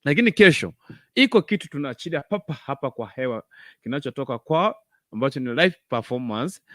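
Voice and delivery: ordinary talk, nothing marked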